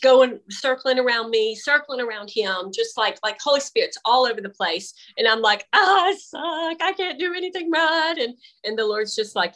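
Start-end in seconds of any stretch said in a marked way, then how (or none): 5.7-8.2 whiny self-pity. Retold or imitated. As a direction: whine it out like a sulking child. Retold self-pity to the Lord.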